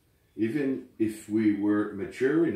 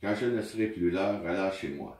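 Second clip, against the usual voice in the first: poem recitation; 2 semitones lower than usual. Acted. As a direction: read it gently, like at a memorial. Reads the poem in French.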